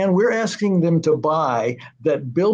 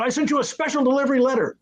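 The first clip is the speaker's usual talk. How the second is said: imitating Stan; 10 semitones higher, faster than usual. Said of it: "He said".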